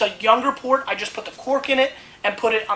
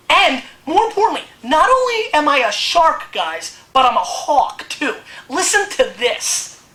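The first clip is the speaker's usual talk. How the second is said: mock outrage; 6 semitones higher, 6 dB louder than usual. Mock outrage at being called shark.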